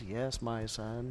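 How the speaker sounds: low, slow, soft fatherly voice; gentle voice of God. Retold or imitated. Pastor voicing God forgiving a penitent at the altar, low, slow and soft. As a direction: answer slowly and softly like a kind father. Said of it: "And he says".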